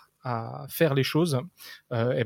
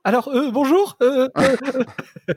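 high, loud, stumbling mock greeting; hammed-up nervous pitch; 11 semitones higher, 6 dB louder, slower than usual. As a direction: play a flustered pitch opener, voice jumping up. Mock start of an elevator pitch.

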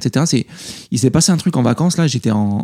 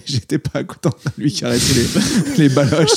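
laughing. Real, not acted. Laughing at his own joke.

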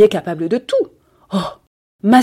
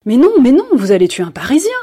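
much higher, louder alarmed protest; loud, high panicked objection; exclaiming in alarm; 5 semitones higher, 6 dB louder than usual. Acted. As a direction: cry out to stop her. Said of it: "s'écria Georges Clérin".

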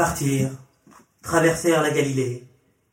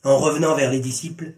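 plain reading voice

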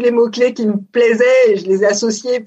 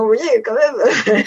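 amusée, en riant; slower than usual. Retold or imitated. Retold proud remark breaking into laughter.

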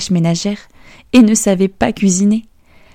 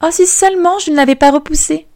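much higher, lamenting princess; a hammed-up pang of regret; regretful sigh; 9 semitones higher, faster than usual. Princess regretting rejecting the king, much higher lament. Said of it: "La princesse soupira de nouveau".

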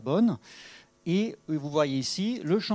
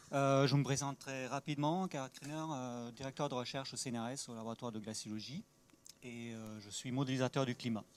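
faint voice, far from the mic; speaking too softly to be heard; too quiet; 11 dB quieter than usual. Real, not acted. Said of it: "il faut que je parle plus fort".

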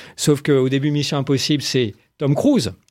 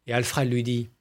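normal talking voice

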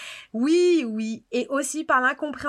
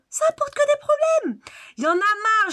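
annoyed rant; 8 semitones higher than usual. Acted. Critic ranting at James Bond, high exasperated outburst. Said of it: "Ouais, je suis énervée".

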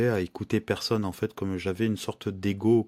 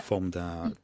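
ordinary delivery

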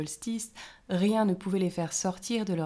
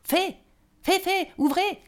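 much higher and louder shout at the door; loud call; 12 semitones higher, 10 dB louder than usual. A narrator's reading. The gnome calls the fairies from outside, shouting loudly. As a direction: bang on the door with your voice. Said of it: "cria une voix forte".

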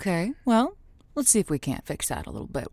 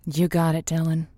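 usual speaking voice

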